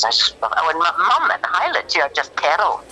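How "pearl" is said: "pearl" in two syllables, like "perr-ul"; Scottish pronunciation. Retold or imitated. Imitating mum's word, "pearl" as Scottish "perr-ul". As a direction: say "pearl" as a Scot, stretched to "perr-ul". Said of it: "just some of his pronunciations".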